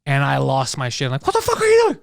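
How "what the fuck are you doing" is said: high, loud exasperated yell; retold yelling. Yelling at a buddy who pasted without copying, exasperated. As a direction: yell in disbelief at a clueless coworker. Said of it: "I remember yelling".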